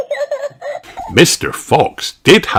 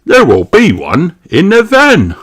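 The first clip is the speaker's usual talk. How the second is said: louder, higher salesman fox; Mister Fox voice; 6 semitones higher, 9 dB louder than usual. Acted. Mister Fox offering his van wares, jolly call. Catchphrase again.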